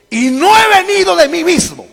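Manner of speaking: high, loud proclamation; crying out. Acted. Preacher quotes what they did not understand, loud cry. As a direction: lift the voice and declare it forcefully. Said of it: "Y dice".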